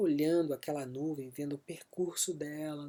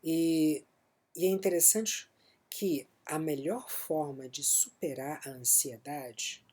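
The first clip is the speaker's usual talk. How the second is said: usual speaking voice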